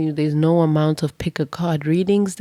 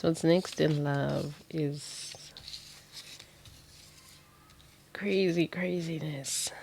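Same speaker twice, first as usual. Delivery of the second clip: hushed whisper; 10 dB quieter, slower than usual. Real, not acted. Tarot reader repeats line mysteriously.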